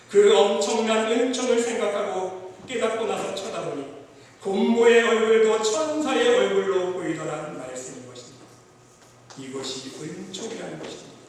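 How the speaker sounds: loud, high preacher's voice pressing each phrase; fervent preaching. Acted. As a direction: preach it out with full force to the congregation. Preaching at full force.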